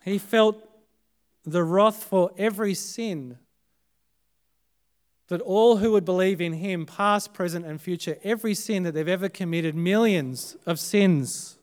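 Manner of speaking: normal talking voice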